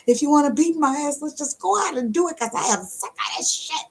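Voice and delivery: high mock rant; hammed-up exasperation. Fed-up partner daring the other to fight, exasperated. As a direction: rant it out high, at the end of patience.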